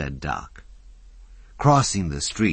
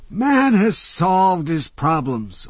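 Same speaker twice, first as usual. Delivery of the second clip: put-on high, loud grand old man; a lightly theatrical old philosopher lecturing; old orator; 14 semitones higher, 8 dB louder, slower than usual. A narrator's reading. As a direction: hold forth with gleeful doom. Old Jay's voice.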